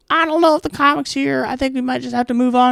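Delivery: mock the host giving up on the comic; clueless host. Host telling the crowd the comic is gone, high mock voice. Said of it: "And he's like".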